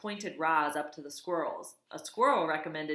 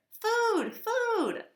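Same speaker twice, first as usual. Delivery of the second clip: very high, loud squeaky begging; tiny baby bird crying for food; hungry gosling peep; 6 dB louder than usual. Acted. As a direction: squeak for food like a starving chick.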